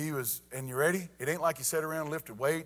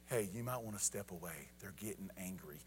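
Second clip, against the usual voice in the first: very low, hushed, casual warning; unruffled dad; 6 semitones lower, 11 dB quieter than usual. Retold or imitated. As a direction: murmur it calmly amid danger. Calm dad amid stinging bees.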